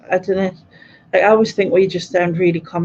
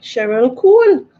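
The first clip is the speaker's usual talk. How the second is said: higher, louder mock exclamation of a name; mimicking commenters; 5 semitones higher, 6 dB louder than usual. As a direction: imitate people calling out a celebrity comparison. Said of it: "I always get like".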